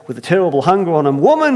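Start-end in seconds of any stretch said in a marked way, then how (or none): 1.2-1.6 loud, much higher bellow of "Woman"; shouted call. Acted. First man shouting for his wife. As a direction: call her in from another room. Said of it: "he shouted".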